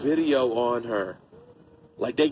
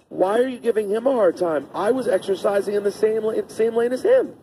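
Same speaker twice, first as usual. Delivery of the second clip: put-on raised, childlike protest; little girl's voice; 7 semitones higher than usual. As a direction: play a ten-year-old objecting. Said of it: "and is like".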